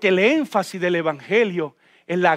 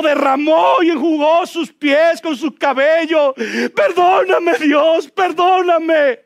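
higher, louder fervent shouted plea; impassioned preaching; 7 semitones higher, 5 dB louder, slower than usual. Real, not acted. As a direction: cry it out and preach. Preaching climax. (predicación ferviente a gritos)

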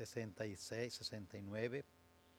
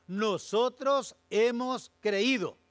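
louder, higher, slowly proclaimed; loud emphatic declaration; 10 semitones higher, 13 dB louder, much slower than usual. Shown as a demonstration. Pastor repeating Peter's answer to the congregation as a loud, slow proclamation. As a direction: proclaim it with full conviction. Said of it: "Note, note que la respuesta".

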